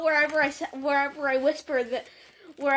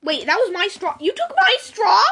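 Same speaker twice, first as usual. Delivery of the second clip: loud, high outrage building; outraged disbelief, pitched up; indignant accusation; 5 semitones higher, 8 dB louder than usual. Real, not acted. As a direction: accuse them, rising to a yell. Outraged about a taken straw.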